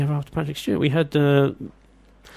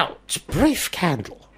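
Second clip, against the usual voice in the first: hammed-up Shakespeare; 4 semitones higher, slower than usual. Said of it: "mocking the Macbeth clip".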